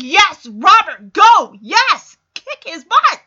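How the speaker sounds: loud, high cheering for Robert; fist-pumping cheer. Retold or imitated. Fan cheering Robert as he fires the butler, loud high cheer. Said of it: "pumping my fist".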